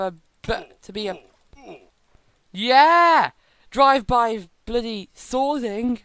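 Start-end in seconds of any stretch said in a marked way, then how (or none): 2.5-6.0 loud, higher, slow battle cry; triumphant shout. Real, not acted. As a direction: roar it in triumph. Triumphant attack.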